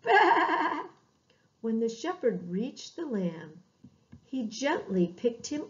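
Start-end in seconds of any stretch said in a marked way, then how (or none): 0.0-0.9 lamb's bleat. Acted. Scared lamb waiting.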